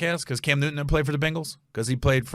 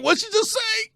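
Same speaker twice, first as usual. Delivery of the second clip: shocked outburst. Retold or imitated. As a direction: blurt it out in shock, way up high. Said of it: "I was like".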